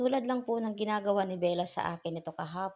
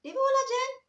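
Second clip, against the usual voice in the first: much higher, louder exasperated question; insist, fed up, pitch jumping; squeaky exasperated repeat; 7 dB louder than usual. Real, not acted.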